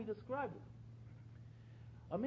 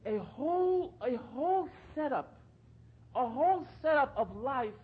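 loud, high, slow fervent preaching; fervent sermon peak; preaching at full force; 7 semitones higher, 9 dB louder than usual. Acted. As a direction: drive home the message from the pulpit.

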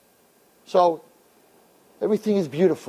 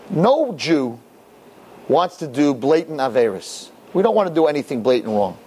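usual speaking voice

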